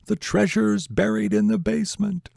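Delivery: high-pitched mock yell to someone on screen; yelling at the hero. Moviegoer to the hero on screen, high mock yell. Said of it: "yelling at the hero".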